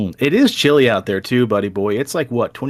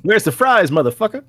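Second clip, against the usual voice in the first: shouting; 10 semitones higher, 5 dB louder than usual. Retold or imitated. Continuing the phantom's rowdy yelling.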